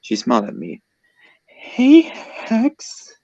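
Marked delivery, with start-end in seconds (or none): 1.5-3.1 very high-pitched character greeting; squeaky little girlfriend voice; sweet girlfriend voice. A narrator's reading. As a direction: put on a tiny high voice to say hello.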